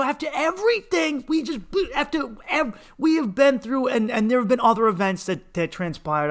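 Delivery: high, loud yelling that eases at the end; angry shout. Retold or imitated. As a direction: shout it at her, then drop. Shouting at mother again.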